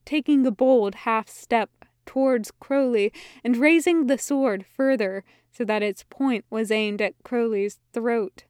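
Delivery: ordinary delivery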